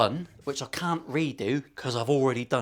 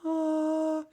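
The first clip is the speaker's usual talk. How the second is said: high squeak. Real, not acted. High yelp while showing the bevel.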